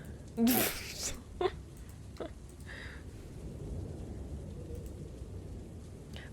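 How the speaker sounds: soft, mostly voiceless laughter; amused laugh. Real, not acted. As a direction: let out a quiet, breath-led laugh. Amused by shirtless Leon.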